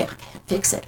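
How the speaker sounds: whispered, barely there; retold hopeless whisper; despairing whisper. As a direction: breathe it out in helpless despair.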